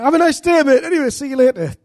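high, loud, hasty mock farewell; flustered goodbye. Preacher fleeing an awkward chat with a stranger, flustered. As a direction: re-enact bailing out of a failed chat. Flustered escape from failed conversation.

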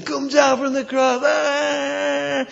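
high, jeering shout from the crowd; a crowd taunting a dying man, shouting; mocking shout. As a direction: yell it up at the cross in scorn. Crowd mocking Jesus on cross.

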